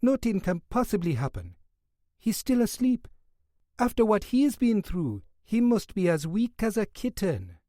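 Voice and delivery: West Indian nurse. Acted. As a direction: gently coax the guard away. Nurse reassures the guard.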